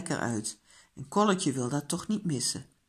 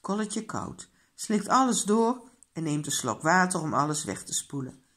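usual speaking voice

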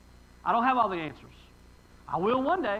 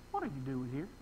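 low, soft aside; quiet questioning as God; 8 semitones lower, 8 dB quieter than usual. Retold or imitated. God questioning Elijah in hiding, low and quiet. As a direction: drop low and soft, lightly voicing God.

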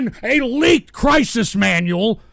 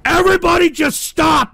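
high, loud plea to stop; fed-up, pleading yell; exasperated shout; 7 semitones higher, 3 dB louder than usual. Real, not acted. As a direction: yell over everyone to halt them. Host yells at trolls to stop.

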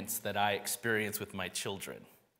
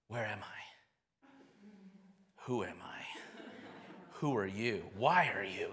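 soft, groggy mumble of someone just woken; hushed, bewildered imitation; dazed waking child; 3 semitones lower, 5 dB quieter than usual. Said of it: "daze, this kind of fog".

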